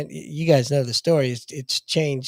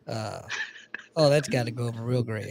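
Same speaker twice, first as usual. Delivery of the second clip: usual speaking voice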